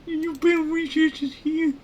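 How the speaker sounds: crazy scientist, extremely high and loud; very high, forceful character voice; Larry. Acted. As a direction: squeak it out as a crazy cartoon researcher. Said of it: "I did a crazy voice for that guy".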